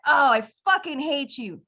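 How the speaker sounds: hammed-up heartbroken anger; mock betrayed outburst. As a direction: rant at the author like you were stabbed in the back. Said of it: "and you're like".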